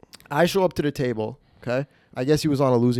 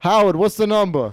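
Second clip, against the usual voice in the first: higher-pitched mock Italian-American demand; Stefano; 6 semitones higher, 3 dB louder than usual. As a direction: imitate a gambler hectoring the dealer. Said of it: "Every time he would always ask".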